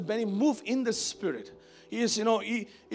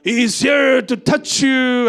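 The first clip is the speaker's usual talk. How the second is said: big, slow, high-pitched preacher's call; Pastor Benny; 5 semitones higher, 10 dB louder, much slower than usual. Retold or imitated. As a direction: proclaim it loudly, stretching the words. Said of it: "he's talking like that".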